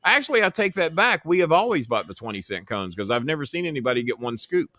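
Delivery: ordinary delivery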